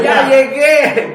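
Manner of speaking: loud high slow call with a laugh; imitated shout. Preacher imitating the late elder shouting his arrival in heaven. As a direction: belt out the old man's arrival call.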